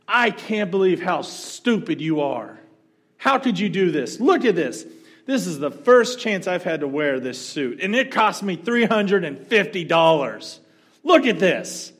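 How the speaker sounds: loud, high furious tirade; angry yell. Retold or imitated. Angry man yelling at the waitress over his suit. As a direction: rip into the waitress at full volume.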